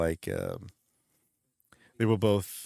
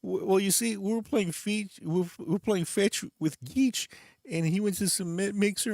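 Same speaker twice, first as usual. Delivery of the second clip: very high-pitched backwoods yokel voice; 11 semitones higher than usual. Retold or imitated. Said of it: "Cletus is like".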